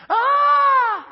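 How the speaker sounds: sharp high scream of pain; hit hard crying out. Retold or imitated. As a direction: yelp out loud as if cut. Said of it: "I hear this, this noise from the kitchen".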